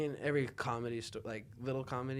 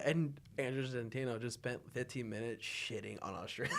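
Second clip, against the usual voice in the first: normal talking voice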